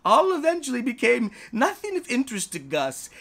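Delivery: ordinary delivery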